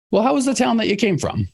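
put-on lifted high voice for the old man at the crossroads; wise old man.

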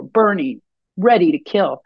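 usual speaking voice

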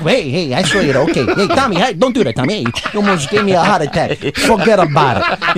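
a light Brooklyn wiseguy, startled and jumpy; Brooklyn tough guy. Retold or imitated. Brooklyn native imitating a jumpy local tough guy. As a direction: play a nervy Brooklyn guy dodging trouble.

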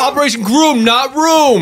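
high, projected correction over noise; shouted correction. Acted. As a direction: call out "Groom, not room".

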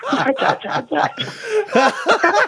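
high slow "hacha" through laughter; slow breathy "hacha" dissolving into laughter; laughing imitation of mate. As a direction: mimic him while in stitches. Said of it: "I just heard this".

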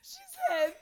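very high, squeaky sobbed words; laughing and crying. Real, not acted. Repeating a friend's sad question, voice broken by crying. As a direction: squeeze the words out through real tears and laughter.

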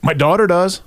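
eager quick answer. Retold or imitated. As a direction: jump at the offer right away. Eagerly grabbing wheelchair offer.

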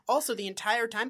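normal talking voice

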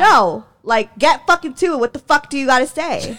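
pushy, heated impatience; bad attitude. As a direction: cut the small talk, demand an answer. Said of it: "bad fucking attitude".

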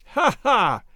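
high, loud acted "ha, ha"; a hard, mocking theatrical laugh; voiced-out laugh of an irritable man; angry man's laugh. A narrator's reading. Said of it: "laughed the angry little man".

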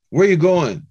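high, loud call from a van. Retold or imitated. Brother in van calls to the walking speaker, loud and high. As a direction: hail someone from the road.

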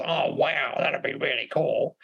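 drawn-out Aussie bloke reaction. Retold or imitated. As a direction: imitate an easygoing Aussie bloke's response. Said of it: "gave the Aussie bloke response".

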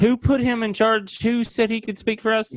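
high indignant protest; mock outrage. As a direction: act scandalized at him.

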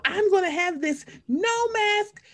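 high, slow, drawn-out mock declaration; mocking protesters. Mimics anti-mask protesters.

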